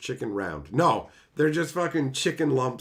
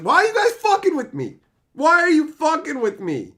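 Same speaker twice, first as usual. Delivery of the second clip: high, loud exasperated yell; indignant shout; 12 semitones higher, 8 dB louder than usual. Real, not acted. Streamer yelling at chat that's trolling him, high indignant shout. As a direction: shout it like everyone's ganging up on you.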